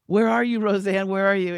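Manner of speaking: high, loud calling out for a missing host; frantic calling. Retold or imitated. Producer calls for the missing star minutes before airtime, frantic and loud.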